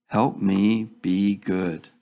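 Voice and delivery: ordinary delivery, nothing marked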